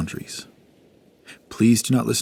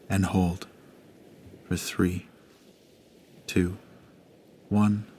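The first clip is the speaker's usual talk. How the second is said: hushed, unhurried breathing guide; guided meditation. Acted. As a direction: gently guide the listener through each breath.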